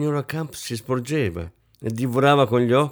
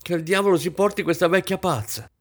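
higher-pitched irritated curse; almost out loud; 8 semitones higher than usual. A narrator's reading. Hostess cursing her guest Isabel, almost aloud and exasperated. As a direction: curse her, barely holding it in. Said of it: "aggiunse quasi ad alta voce".

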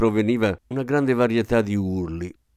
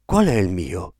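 lower, lightly gasped question; panting; 4 semitones lower than usual. A narrator's reading. Father asking which baby is his, panting. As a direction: ask it short of breath. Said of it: "ansimò mister Button".

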